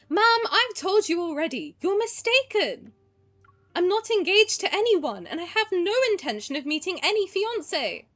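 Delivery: put-on posh British accent, defying the aunt; her childhood British accent. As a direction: play the heroine in her old British accent. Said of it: "full three-year-old [name]'s accent".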